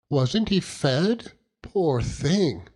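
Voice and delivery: put-on high, slow pitying girl; a touch of girlish pity; lightly voiced Dora. A narrator's reading. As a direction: coo over the poor horse. Dora pities the horse.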